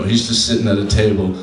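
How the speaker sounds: ordinary delivery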